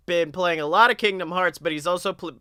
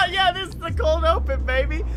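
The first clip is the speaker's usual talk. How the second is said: laughing; 9 semitones higher than usual. Real, not acted. Laughing through hype line.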